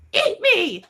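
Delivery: high-pitched cartoon defiance; hammed-up, high retort; defiant captive. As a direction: spit it back in a little squeaky voice. Captive defies the villain.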